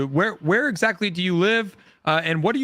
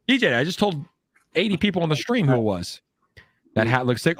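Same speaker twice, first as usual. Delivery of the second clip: ordinary delivery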